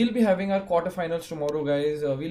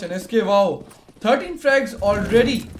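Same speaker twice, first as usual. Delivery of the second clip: esports caster hype; 3 semitones higher, 7 dB louder than usual. Real, not acted. Hyped caster call.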